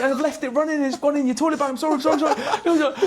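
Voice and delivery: high, loud, panicked apology; imitated freaking out. Roommate confessing he left the tap running, imitated freaking out. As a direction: gabble a mock-desperate excuse, pitch right up. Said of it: "He's, like, freaking out".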